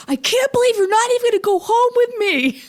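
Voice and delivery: high, loud retold yell at her back; retold scream. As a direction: shout it after her as she leaves. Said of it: "Screams it".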